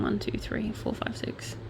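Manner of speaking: soft count fading into whisper; hushed aside to yourself. Real, not acted. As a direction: count to six, dropping under your breath. Counting to self mid-report.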